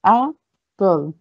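"apple" split into "a", pause, "ple"; two separate beats in "apple"; syllable by syllable. Shown as a demonstration. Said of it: "طب إحنا بننطقها إزاي".

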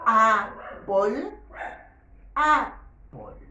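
put-on Apple said loudly as "A-pol" with a falling end, for children to copy; modelled repetition. Shown as a demonstration. Teacher leads children in repeating Apple, loudly. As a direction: call out Apple each time for the class to echo. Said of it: "repetimos, repite".

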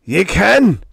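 very high, loud snarl. A narrator's reading. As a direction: spit it out as a command. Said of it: "the cadaverous individual with a snarl".

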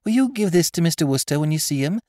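put-on eager little girl. A narrator's reading. Girl handing over the cigarette case, fast, high and loud. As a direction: rattle off the errand. Child voice.

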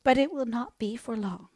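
high, thin voice of a sick mother; an invalid mother gently apologising to her children, high and frail; frail dying mother. A narrator's reading. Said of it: "said she".

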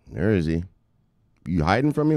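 usual speaking voice

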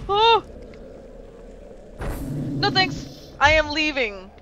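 high alarmed cry; panicked retreat. Real, not acted. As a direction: flee in a panic. Fleeing from enemies.

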